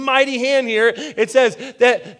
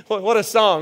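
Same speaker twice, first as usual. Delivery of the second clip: ordinary talk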